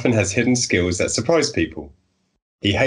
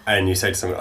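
ordinary delivery

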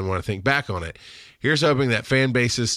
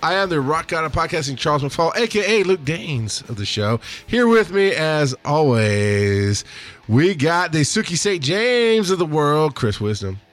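slow, higher hyped-up show opening; podcast host intro; 5 semitones higher than usual. Acted. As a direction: welcome listeners like a radio host.

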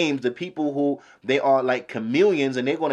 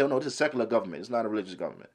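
softer, lower mock brush-off; imitating rulers waving away their promise, dropping quietly; mock dismissive rulers; 5 semitones lower, 8 dB quieter, much faster than usual. Lecturer mocking rulers' brush-off, soft and dismissive. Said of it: "they say".

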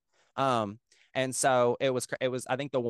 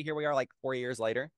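ordinary delivery

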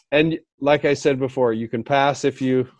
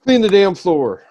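curt boss barking an order, higher and a little louder; bossy order; 7 semitones higher, 4 dB louder than usual. Retold or imitated. Boss storming in, ordering an employee: curt command. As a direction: snap it like a rude boss. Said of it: "like a boss coming in and say".

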